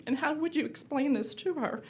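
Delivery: ordinary talk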